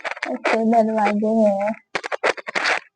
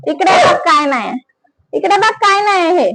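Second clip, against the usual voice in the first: coaxing; 7 semitones higher than usual. Real, not acted. Coaxing dog in Marathi.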